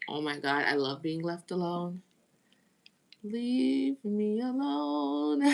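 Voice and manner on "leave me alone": drawn-out mock plea. Joking about loving solitude.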